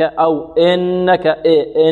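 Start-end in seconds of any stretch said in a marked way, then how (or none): 0.5-1.3 "إنك" opened toward "ennaka"; slowly colour the i of "إنك" with a; tilted kasra. Shown as a demonstration. Teacher showing a faulty imala, opened vowel. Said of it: "أو إشمام الكسرة شيء من الفتح".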